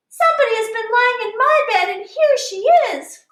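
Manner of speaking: very high, fast tiny bear voice; baby bear shrieking the discovery; little wee bear crying. Acted. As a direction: cry out high and quick as the smallest bear. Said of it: "cried the little wee bear in his little wee voice".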